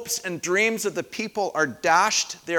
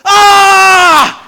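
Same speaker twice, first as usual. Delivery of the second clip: very loud, high yell; a friend's sudden loud yell in the dark car; startling yell; 13 semitones higher, 18 dB louder than usual. Retold or imitated. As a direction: jolt a sleeping friend awake. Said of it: "he'd go".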